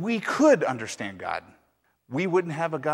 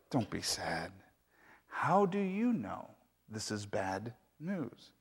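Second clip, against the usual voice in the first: lower, softer and slow, unhurried; calm; 3 semitones lower, 6 dB quieter, slower than usual. Acted. Old man calmly tells grieving neighbors not to be sad. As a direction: settle them down slowly. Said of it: "Sai Wang calmly said".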